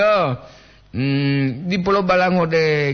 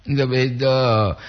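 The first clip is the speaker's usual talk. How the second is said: usual speaking voice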